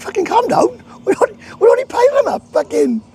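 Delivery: angry shout. Retold or imitated. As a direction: fire it back fast, close to tears with rage. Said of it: "Tom said".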